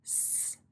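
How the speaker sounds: "S." a voiceless hiss, no vowel; just the hiss of "S."; isolated s sound. Shown as a demonstration. Teacher naming the first sound of sod, hissed "S.".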